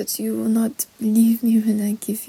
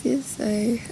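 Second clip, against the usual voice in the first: ordinary talk, nothing marked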